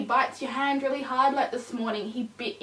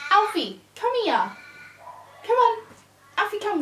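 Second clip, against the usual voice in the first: higher urging call; calling the puppy; 9 semitones higher than usual. Real, not acted. Owner calling puppy away from wee, higher urging call. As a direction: get him to come.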